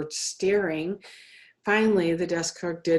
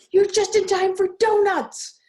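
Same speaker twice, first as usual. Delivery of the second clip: a lightly voiced, higher, louder shopkeeper; cheery grocer; 12 semitones higher, 8 dB louder than usual. A narrator's reading. Grocer greeting Ron at the store, higher and cheery. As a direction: offer the donuts like a jolly shopkeeper.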